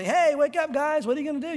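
loud, high taunting call across the valley; mock taunt. Preacher taunting sleeping soldiers from a hilltop, loud jeering call. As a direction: jeer at them from the hilltop. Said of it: "You could taunt them".

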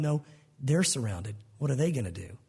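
prophet's low, relaxed answer to panic; calm and unbothered. Retold or imitated. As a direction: settle him with a deep, easy calm. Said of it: "Elijah's going".

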